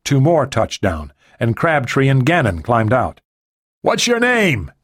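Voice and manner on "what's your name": much higher, calling over the rotors; high shouted question over the noise. A narrator's reading. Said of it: "Tsang shouted to the leader".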